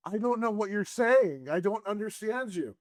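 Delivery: high puzzled villain voice; Darth Nader voice. Retold or imitated. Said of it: "scene with Darth Nader".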